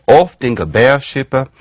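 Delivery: put-on reconstructed Old English accent with a Germanic lilt; half-invented Old English accent. Shown as a demonstration. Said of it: "I don't know what accent this is".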